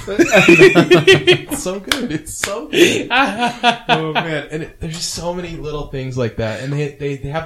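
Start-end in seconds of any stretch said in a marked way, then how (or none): 0.0-3.1 squeals of laughter, much higher and louder; slow, breathless laughing rave. Real, not acted. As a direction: laugh it out, delighted. Laughing at favorite scene.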